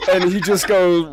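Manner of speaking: ordinary talk, nothing marked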